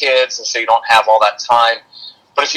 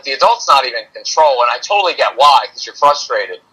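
normal talking voice

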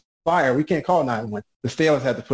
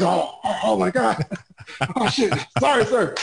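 mock shock; 7 semitones higher than usual.

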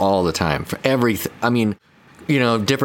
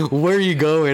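mock relatives' reply; 9 semitones higher than usual. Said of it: "And they're like".

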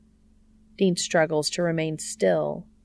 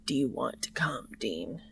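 softer, dropping lower on Dean; ravaged low voice; 3 semitones lower, 6 dB quieter than usual. A narrator's reading. Cass asking Dean in bed, ravaged low voice. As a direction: let the voice sound worn and low. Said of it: "in his sex-ravaged voice".

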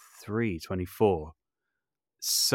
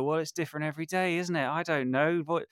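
awkward shrug; 8 semitones higher than usual. Retold or imitated. Imitating own awkward answer.

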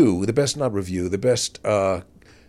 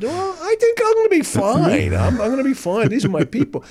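much higher, cocky and breezy; cocky young self; 13 semitones higher than usual. Retold or imitated. Young comic brushing off the warning, high and cocky. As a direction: play your naive younger self, overconfident. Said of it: "I said".

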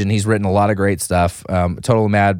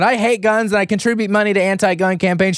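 higher mocking voice; mock hypocrite; 9 semitones higher than usual. Host mocking an actress's anti-gun stance, higher. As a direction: parody her sanctimony. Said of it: "Missus Anti-Gun".

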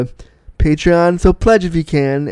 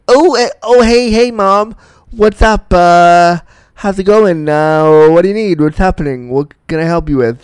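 high, loud flustered stammer easing down; awkward, caught out; 3 semitones higher, 5 dB louder than usual. Acted. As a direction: answer mom as if caught in the act.

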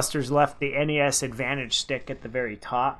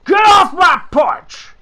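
put-on grumpy old man yelling; high, loud cranky old-timer voice; old prospector; 12 dB louder, slower than usual. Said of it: "He looked like a grumpy old dude".

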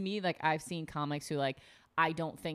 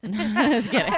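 laughing; 5 semitones higher, 12 dB louder, much slower than usual. Real, not acted. Laughs right after a joke.

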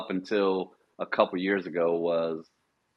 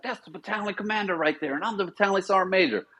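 imitating the sergeant major, much higher and brisk; mock sergeant major; 11 semitones higher, faster than usual. Retold sergeant major scolding.

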